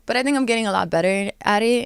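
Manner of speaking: ordinary delivery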